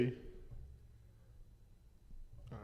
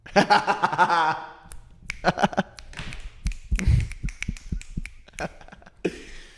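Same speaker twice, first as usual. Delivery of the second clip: high rising burst of laughter; long laugh; 5 semitones higher than usual. Real, not acted. Laughing after reciting lyrics.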